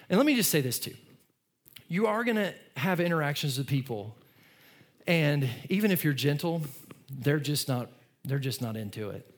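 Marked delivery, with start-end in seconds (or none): none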